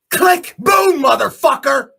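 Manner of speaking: very high and loud burst; explosive shout. Real, not acted. Picturing his media attack going off, explosive shout. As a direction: blow it up.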